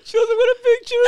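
very high, loud comic wail; hammed-up sobbing complaint; mock crying. Host plays actress rejected by a fan, mock crying.

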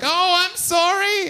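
very high, slow, exaggerated apology; hammed-up sorry in a squeaky voice; apologetic gang member. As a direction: grovel comically as the tough guy. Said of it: "very apologetic".

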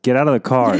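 hurried drop-off shove-off; impatient parent. Retold or imitated. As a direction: bark it like a rushed parent. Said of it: "everyone else is like".

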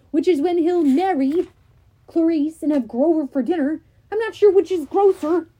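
big voice, pushed loud; loud, eager and disgusted. Acted. Tyson voiced in audiobook.

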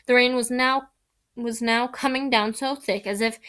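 ordinary delivery, nothing marked

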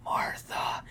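breathy whispered name; mock whisper. Fan mocks the famous Martha line in a whisper. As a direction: hush it dramatically. Mocking Batman v Superman line.

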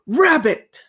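a touch of a royal cry; king calling out. A narrator's reading. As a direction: call the rabbit to account. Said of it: "cried the king".

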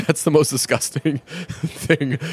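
laughing through the words. Real, not acted. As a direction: laugh while you say it. Laughing at a gross memory.

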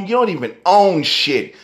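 ordinary talk

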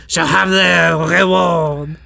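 slow, sustained French crooning; French accent. Acted. Finishing Stars as a smoky cabaret number, slow French accent. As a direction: draw out the ending in mock French accent. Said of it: "this version of Stars being sung".

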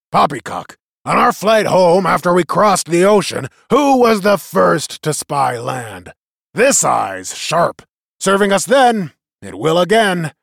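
put-on rally the crowd like an old war chief; leader's address. A narrator's reading.

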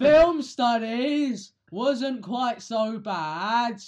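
very loud, high, drawn-out announcement; mock overloud reply. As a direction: blare every word slowly as if to prove you can be heard. Answers remark on being quiet.

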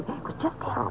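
breathy near-whisper, voice dropping away; hushed. Acted. Shaken cashier telling the ranger, whispered. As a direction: let it fall to an awed whisper.

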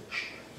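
a long unvoiced shh; hushing shh. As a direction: hush the room with a breathy shh. Isaiah's hush before God.

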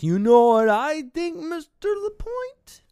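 very high, loud start, drawn out; hammed-up eerie confrontation; old man impression. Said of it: "you can see his true character".

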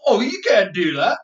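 high, loud, whiny protest; mock indignation. Imitating someone called a Kiwi, loudly indignant. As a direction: wail it in mock offence. Mimics offended reply to being mislabelled.

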